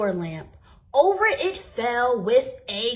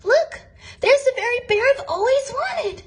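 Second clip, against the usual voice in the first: put-on high, bright-eyed child's delight, louder; a girl who has found her dream bear; gasping child's delight, much higher; excited little girl; 13 semitones higher, 4 dB louder, faster than usual. A narrator's reading. Girl sees the bear she wants.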